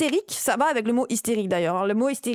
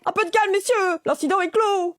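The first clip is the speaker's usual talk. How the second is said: pompous peacemaker; 7 semitones higher than usual. Acted. Voicing the MP calming.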